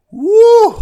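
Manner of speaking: high, loud cheer; real excited cheer; excited whoop. Real, not acted. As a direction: whoop with delight. Cheering about halftime show.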